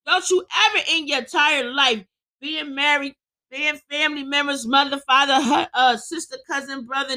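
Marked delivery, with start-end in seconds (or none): none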